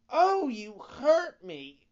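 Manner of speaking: very high, very loud, slow cry of pain; a small hurt voice wailing from the wood; tiny voice complaining. A narrator's reading. Wooden log cries out to the carpenter, high and loud. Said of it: "complained the same tiny voice".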